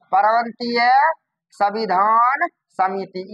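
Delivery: slow, broken into separate words; dictating. Shown as a demonstration. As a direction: pause after प्रांतीय and stretch संविधान. Teacher repeats name for class.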